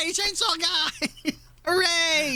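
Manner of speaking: high, slow falsetto cheer; giddy welcome for the chainsaw guy; mock delight. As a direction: squeal like a thrilled fan. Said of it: "and you're like".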